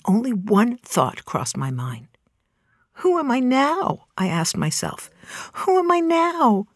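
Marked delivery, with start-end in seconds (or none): 2.9-6.6 high-pitched, wondering self-question; anguished self-questioning. Retold or imitated. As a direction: ask yourself in a raised, lost voice. Asking herself in shock after cancellation.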